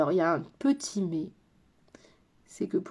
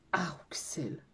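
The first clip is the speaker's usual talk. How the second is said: whisper; 5 semitones lower, 9 dB quieter than usual. Real, not acted. Sighs over viewer's nickname.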